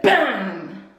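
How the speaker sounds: echoing off tiled walls; booming bathroom echo; echoey bathroom. Real, not acted. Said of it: "my voice is really echoey in here".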